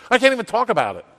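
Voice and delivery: high, exasperated burst; exasperated outburst. Real, not acted. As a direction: break off the calm act in exasperation. Gives up the calm act, exasperated.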